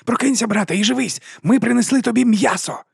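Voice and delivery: high, urging wolf call; wolf commanding. A narrator's reading. Wolf ordering sleeping dragons to wake and feed, high and urgent. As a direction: order the dragon awake, eager. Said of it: "тоді наказував".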